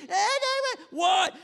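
high, drawn-out mock wail; tantrum child. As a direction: bawl it like a refusing toddler.

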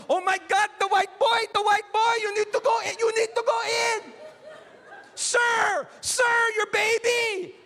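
high, loud panicky shouting; panicked bystanders. Retold or imitated. Beach crowd shouting at the dad to save his son, high and frantic. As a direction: yell in alarm at a father whose kid seems to drown. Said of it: "They're like".

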